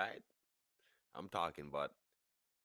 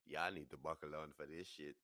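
usual speaking voice

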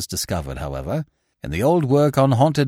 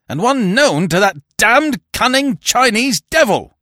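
much higher, louder raging bellow; furious roar; 14 semitones higher, 8 dB louder than usual. A narrator's reading. Barton cursing the Chinese devil, roaring much higher. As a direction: spit out the curse at full force. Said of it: "roared Barton".

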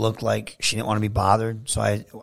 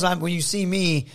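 ordinary talk, nothing marked

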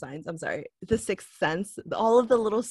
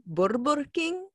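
put-on "Burger King" in the parents' accent with rolled R; 6 semitones higher, slower than usual. Imitating her parents' name for it, rolled-R accent. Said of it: "because you don't roll the R".

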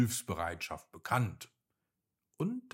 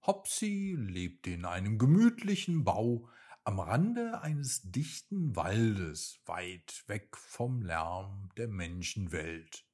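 ordinary delivery, nothing marked

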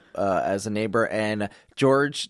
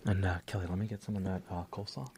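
softer, lower aside whisper; 4 semitones lower, 11 dB quieter than usual. Real, not acted. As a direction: murmur it under the breath. Asking for coleslaw mid-meditation.

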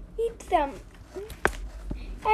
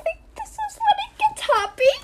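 much higher, fast, eager; hopeful excitement; 12 semitones higher, 3 dB louder, faster than usual. Pretend. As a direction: perk up with sudden hope. Hoping the story turns happy.

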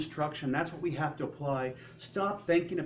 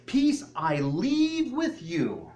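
slow, solemn, louder proclamation; Jesus speaking; 4 semitones higher, 6 dB louder, much slower than usual. Retold or imitated. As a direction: draw out the blessing slowly. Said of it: "Jesus tells his disciples".

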